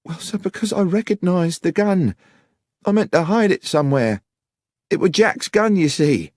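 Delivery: put-on very high young countrywoman's voice; Miss Miller, anxious confession. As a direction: admit it anxiously, pitched right up.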